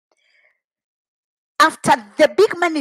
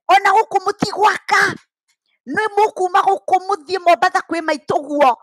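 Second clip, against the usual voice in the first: raised, shouting threat in Kikuyu; angry threat; 6 semitones higher than usual. Retold or imitated. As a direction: threaten them like a furious father. Dad threatening brothers, in Kikuyu.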